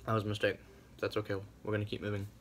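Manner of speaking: chewing while talking; speaking around hot food; mouth partly full. Real, not acted. Seen 0: bit hot pizza bagel, jaw still working, holds bagel while speaking.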